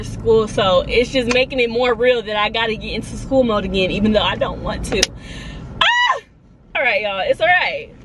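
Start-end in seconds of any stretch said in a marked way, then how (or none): 5.8-6.2 very high, loud yelp; sudden high shriek. Real, not acted. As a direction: let out a frustrated squeal. Releasing emotion with a shriek.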